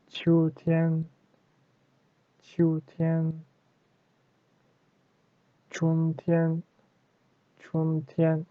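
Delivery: "Fa sheng" to "chun tian", each syllable high and level; double first tone. Shown as a demonstration. Teacher drills first-tone words, high and level. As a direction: chant each pair from "Fa sheng" on, evenly up high. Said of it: "the couple first tone".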